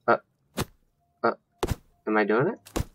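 usual speaking voice

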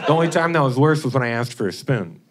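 normal talking voice

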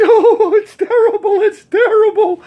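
very high, loud comic wailing; mock crying. Upset mother on a park bench to a vendor, mock crying. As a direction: wail it in a put-on high voice, hammed-up. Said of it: "she starts crying".

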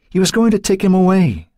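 high, anguished mother's plea; desperate. A narrator's reading. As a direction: plead the injustice. Desperate claim, high far.

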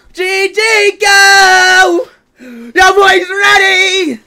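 slow, high, loud showman call; hype announcer. Acted. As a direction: draw it out like a hype man.

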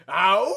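loud pained howl "Ow!". A narrator's reading. Lover howling outside her window, a loud howl. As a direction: let out a loud animal howl. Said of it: "He howled".

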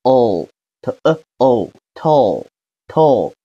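哦 as a dark final L, slowly sounded out; t、呃、哦 apart, then blended into 透; drawn-out 透 ending on a dark L. Shown as a demonstration. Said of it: "了了要发哑巴音".

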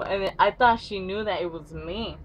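usual speaking voice

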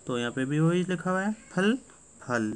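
ordinary talk, nothing marked

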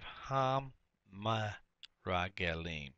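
"ham meragalim" very slowly, broken into pieces; slowly sounded out. Shown as a demonstration. Teacher adding the definite article to spies, slowly sounded out. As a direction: hold "ham", pause, then draw out "meragalim".

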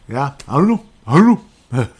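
loud exasperated outburst. Real, not acted.

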